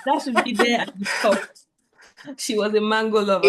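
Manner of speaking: loud, high, giggly reply to the joke; laughing along. Real, not acted. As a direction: play along through laughter.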